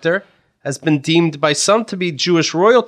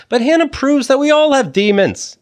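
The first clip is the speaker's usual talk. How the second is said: lively, hammed-up promo read breaking into laughs; 5 semitones higher than usual. Host reading the podcast ad aloud, laughing. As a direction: sell it like a cheesy podcast ad.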